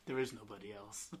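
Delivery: usual speaking voice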